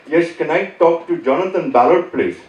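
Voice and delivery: put-on telemarketer with an Indian accent; put-on Indian phone-sales voice. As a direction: imitate an Indian caller politely asking. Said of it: "most of them are Indian".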